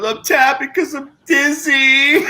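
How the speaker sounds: put-on high, slow drunken whine; imitated drunk wail. Friend mimicking dizzy Joey on the bathroom floor, wailing.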